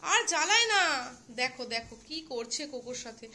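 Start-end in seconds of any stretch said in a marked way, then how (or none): 0.0-0.8 high, loud mock scolding of the pets; playful scolding. Real, not acted. Owner telling the pets to stop bothering their mother, loud mock scold. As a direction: tell the naughty pets off, half in play.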